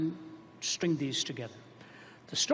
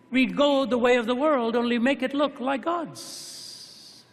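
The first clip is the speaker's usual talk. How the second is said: high, louder preacher's build to the climax; rising preaching; 6 semitones higher, 5 dB louder than usual. Acted. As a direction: drive the point home to the crowd. Sermon climax.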